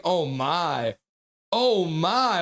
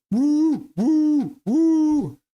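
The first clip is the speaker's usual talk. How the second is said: much higher, louder woo hoots; mock whooping; 11 semitones higher, 6 dB louder, much slower than usual. Streamer reads a chatter's woo as loud high hoots. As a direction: hoot like a party fan. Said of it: "Cosmic Dragon's like".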